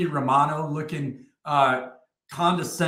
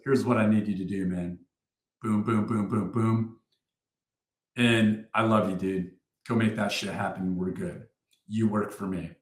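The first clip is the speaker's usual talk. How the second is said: lower, easygoing reassurance trailing soft; reassuring mentor; 6 semitones lower, 5 dB quieter than usual. Retold or imitated. Sergeant major reassures his soldier, lower and calm. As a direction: sergeant major calmly reassuring, lightly imitated. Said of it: "and he goes".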